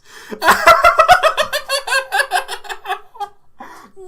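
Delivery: high, loud drawn-out laughing fit; loud laughter. Real, not acted. Streamer cracking up at his own joke, loud high laughter.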